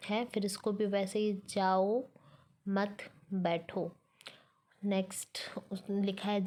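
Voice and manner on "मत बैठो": usual speaking voice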